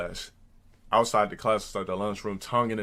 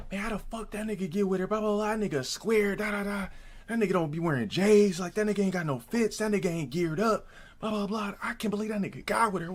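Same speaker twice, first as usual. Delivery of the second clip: mocking haters' gossip; 9 semitones higher than usual. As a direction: imitate jealous haters griping in a higher voice. Haters gossiping about him in story.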